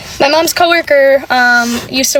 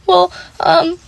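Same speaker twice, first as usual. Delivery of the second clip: small, higher-pitched, halting okay; holding back tears; 5 semitones higher than usual. Retold or imitated. Caller screamed at by the postmaster, acting out held-back tears. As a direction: re-enact trying not to cry. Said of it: "I'm, like, holding back tears".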